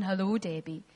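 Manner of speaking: very low, hushed mock-divine voice; mock voice from above. Preacher mimicking the voice she did not hear, low and hushed. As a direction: drop deep and soft, like a disembodied voice. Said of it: "not a voice going".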